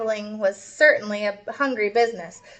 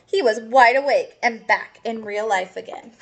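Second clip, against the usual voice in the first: ordinary delivery, nothing marked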